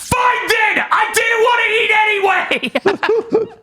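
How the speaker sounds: high, stretched-out petulant yell; hammed-up hurt outburst; mock tantrum shout. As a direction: scream it like a sulking child storming off. Mock tantrum, sulking at dinner.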